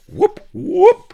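very high, loud whooping; shrieked war whoop. A narrator's reading. Attackers charging home, high shrieked whoops. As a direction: shriek it out as a battle cry. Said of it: "shrieked the Indians".

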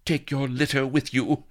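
high, haughty dismissal; cold. A narrator's reading. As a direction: order him off with disdain. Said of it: "said Vanity coldly".

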